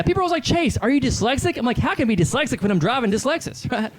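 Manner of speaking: high, swinging hack-comic voice; Chase Freely. Acted. Hack comedian thanking everybody at set's end, high cocky delivery. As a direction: sell the pun brightly, up high, then sign off.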